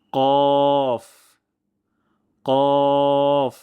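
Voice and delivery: loud, long কফ from the tongue root; heavy qaf. Shown as a demonstration. Teacher modelling for practice, long heavy কফ. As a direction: hold কফ with thick back q. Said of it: "মোটা সাউন্ডে উচ্চারণটি করব".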